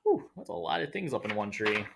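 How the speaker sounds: ordinary delivery, nothing marked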